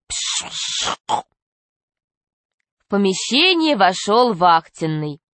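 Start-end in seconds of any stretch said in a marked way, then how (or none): 0.1-1.0 breathy hissing pshh sound; whispered hiss. A narrator's reading. Narrator hisses like escaping air.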